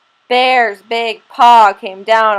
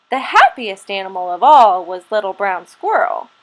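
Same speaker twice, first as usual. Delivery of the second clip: usual speaking voice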